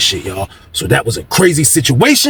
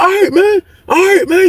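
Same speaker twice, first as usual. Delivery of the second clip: imitating the beaten man. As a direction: give in, scared. Victim surrenders.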